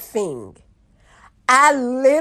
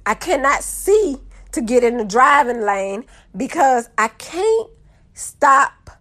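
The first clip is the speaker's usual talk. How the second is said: ordinary talk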